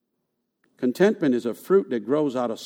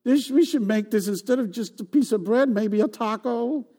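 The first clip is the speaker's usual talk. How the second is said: higher mock-whiny voice; picky whiner; 8 semitones higher than usual. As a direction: whine about the menu. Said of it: "they were like".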